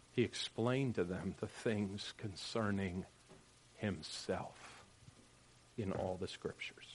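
fading to a whisper. Acted. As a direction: let it fade to a whisper. Reading scripture, fading to whisper.

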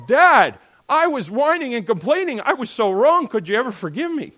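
loud, high plea to a father; hammed-up pleading apology; acted repentant child. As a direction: cry out a heartfelt mock confession.

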